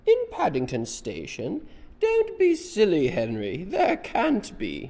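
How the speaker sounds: loud, very high scolding disbelief; high-voiced woman. Missus Brown tells Henry off, high-voiced. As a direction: play a fussy lady in a loud high voice. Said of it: "this is the woman with the high voice".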